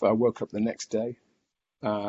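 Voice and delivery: ordinary talk, nothing marked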